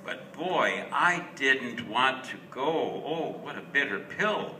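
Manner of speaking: ordinary delivery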